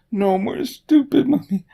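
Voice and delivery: high shrill bratty whine; a loud bratty toddler tantrum; whiny child. Pretend. Mocking whiny child chant.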